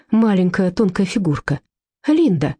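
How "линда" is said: ordinary delivery, nothing marked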